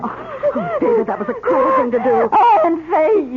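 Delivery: high, tearful reproach; distressed cry. Acted. Aunt tearfully rebukes the husband for waking the girl. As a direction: scold him through tears.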